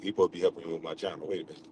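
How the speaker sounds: hushed aside. Real, not acted. Catches himself, hushes.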